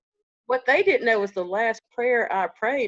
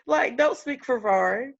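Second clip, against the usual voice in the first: louder, laughing "don't speak for him"; laughing scold; 6 semitones higher, 5 dB louder than usual. Real, not acted. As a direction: scold through leftover laughter.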